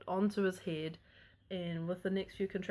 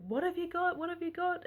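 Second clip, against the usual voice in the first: eager question; 10 semitones higher than usual. Retold or imitated. Staff asking the partner.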